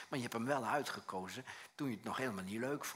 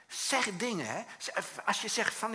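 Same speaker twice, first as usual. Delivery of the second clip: usual speaking voice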